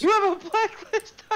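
can't believe what he just heard; incredulous high-pitched yelp; shocked disbelief. Real, not acted. Shocked at friend's customer blacklist.

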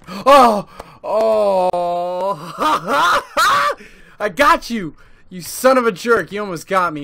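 high, loud laughing relief; relieved laughing shout. Real, not acted. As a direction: laugh off the fright. Survives jump scare.

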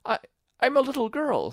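put-on high, hesitant girl's voice; falter, then offer it unsurely; uncertain, high-pitched stammer; rather doubtful. A narrator's reading. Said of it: "rather doubtfully".

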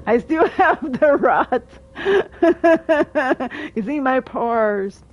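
slow, laughing mock lament; laughing whine. Real, not acted. Streamer laughing at the rot to chat, slow laughing whine. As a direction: moan it through laughter.

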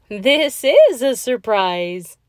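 storybook astonishment, stretched out and loud; surprised exclamation. A narrator's reading. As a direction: exclaim it with drawn-out, emphatic surprise. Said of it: "they exclaimed".

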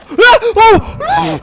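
loud, very high yelps; panicked screams. Real, not acted. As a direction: scream as the shark gets you. Shark attacks player in game.

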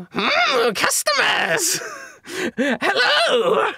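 high, eager little merchant greeting customers; squeaky excited trader; quirky shopkeeper voice. Acted. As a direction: pitch way up and sing out hello like a giddy salesman. GM voices an odd ice cream seller.

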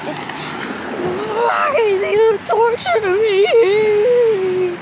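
high, very slow, sobbing question; drawn-out tearful why; crying plea. Acted. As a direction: wail it out, begging. Pleading, crying at tormentor.

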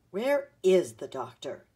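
loud, slow, cross demand; mildly cross, leaning heavily on the words. A narrator's reading. Visitor demanding the doctor from a child, crossly. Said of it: "asked the man crossly".

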